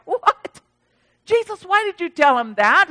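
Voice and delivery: high, loud incredulous protest; mock disbelief. Pastor questioning Jesus about Nathanael, mock incredulous. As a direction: question it in put-on astonishment.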